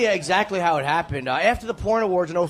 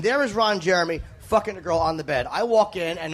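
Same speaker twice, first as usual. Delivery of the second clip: ordinary talk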